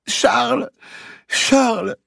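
very high, loud pleading call; repeated desperate call. A narrator's reading. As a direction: call the name over and over in distress. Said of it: "répétait Blanche".

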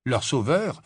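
ordinary delivery, nothing marked

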